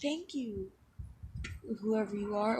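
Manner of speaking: put-on timid girl's thanks. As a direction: thank a stranger shyly, softly. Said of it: "And so Yuri just like".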